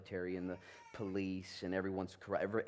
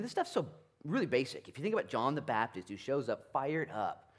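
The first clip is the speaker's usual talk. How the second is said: ordinary talk, nothing marked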